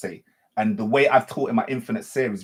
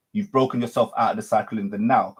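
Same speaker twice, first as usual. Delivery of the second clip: normal talking voice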